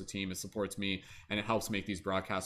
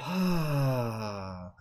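big sigh; 3 semitones higher than usual. Shown as a demonstration. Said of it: "bit of a sigh".

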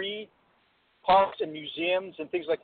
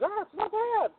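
high-pitched mock reassurance; squeaky, put-on "not that bad" voice; imitated food host; 14 semitones higher than usual. Retelling the TV eater's reaction to oysters, high mock voice. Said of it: "He goes".

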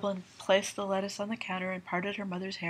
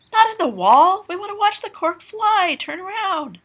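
excited shouting; 13 semitones higher, 17 dB louder than usual. Acted. Melanie shouting at Linus.

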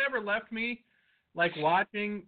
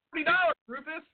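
mock seller; 10 semitones higher, faster than usual.

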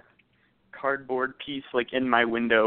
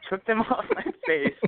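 laughing in disbelief, pitched up; incredulous laughing retelling; 12 semitones higher than usual. Real, not acted. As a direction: laugh through the words, high. Retelling ex ripping glasses off.

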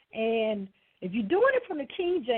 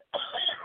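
cough; 4 dB quieter than usual. Real, not acted. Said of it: "Excuse me again".

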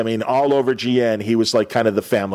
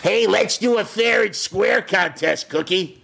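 voicing a kids' show host; 9 semitones higher, slower than usual.

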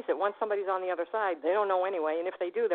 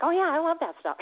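much higher, louder gush of enthusiasm; excited and high; eager delight; 9 semitones higher, 5 dB louder than usual. Retold or imitated. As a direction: gush like a keen student. Eager about brain talk.

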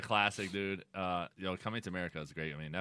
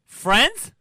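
high, loud disbelieving Friends?; incredulous; 12 semitones higher, 11 dB louder than usual. Real, not acted.

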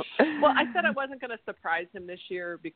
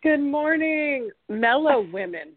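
high, slow drawn-out good morning; sing-song greeting; 7 semitones higher, slower than usual. Real, not acted. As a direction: stretch the greeting out brightly. Guest greets hosts playfully.

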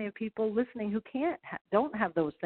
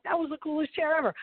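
high-pitched retold delight; excited exclamation; 8 semitones higher than usual. Golfer recalling her wheeled chair as a kid, excited high-pitched exclamation. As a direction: gush over a childhood treasure, pitch lifted high.

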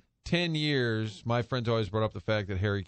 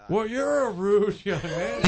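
put-on much higher, louder, slow old broadcaster voice; Harry Caray; 9 semitones higher, 5 dB louder, slower than usual. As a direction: draw it out like a gruff old announcer. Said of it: "was like".

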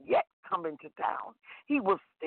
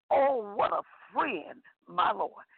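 ordinary talk

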